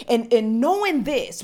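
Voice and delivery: usual speaking voice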